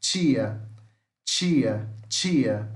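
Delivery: Tia as "tchia", falling on each repetition; teacher modelling affricate T in Tia; palatal affricate demo. Shown as a demonstration. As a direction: say Tia slowly apart with a tch onset. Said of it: "fica assim o som".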